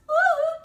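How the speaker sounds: high loud whistle-like woo. Pretend. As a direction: blow an imaginary referee whistle. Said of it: "blowing a whistle".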